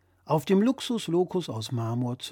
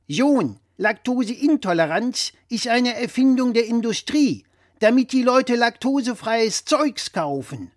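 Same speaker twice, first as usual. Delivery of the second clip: louder, higher know-it-all dad voice; blustering father; 8 semitones higher, 6 dB louder than usual. Acted. Father lecturing his son over the phone, louder and higher. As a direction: play the pompous father correcting his son.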